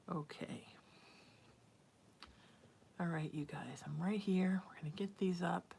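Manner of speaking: hushed setup whisper. Real, not acted. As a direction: whisper to the viewers while working. Hushed aside while setting up.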